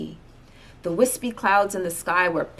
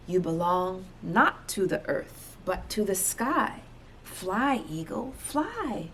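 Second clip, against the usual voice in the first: softer, slowing urge to fly; soft final urging; 7 dB quieter than usual. Acted. Friend to eagle at sunrise, soft slow coaxing. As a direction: coax the bird gently into the sky.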